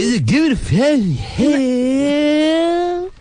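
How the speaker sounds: very high, slow slurred parody; mock lazy drawl. Host parrots co-host's good way to find me, mock drawl. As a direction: wail it out long and smeared. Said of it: "It's like a lazy way to talk".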